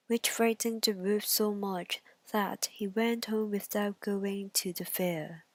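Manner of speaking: ordinary delivery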